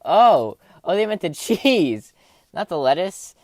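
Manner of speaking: sudden loud "oh" of realizing; realization. Retold or imitated. Retelling the moment she got the joke, loud realization. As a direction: blurt it out as the penny drops. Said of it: "then I was like".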